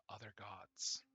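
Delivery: ordinary delivery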